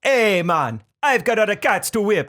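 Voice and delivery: high, lively Trinidadian put-on; mock Trinidad accent. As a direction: call out in a hammed-up Trinidad accent. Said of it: "what they always wanted to sing in Trinidad".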